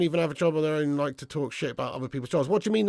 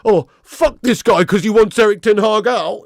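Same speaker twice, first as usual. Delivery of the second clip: mock outraged fan; 4 semitones higher, 8 dB louder than usual. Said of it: "be like".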